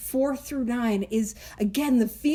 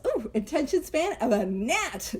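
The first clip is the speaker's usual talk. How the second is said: ordinary delivery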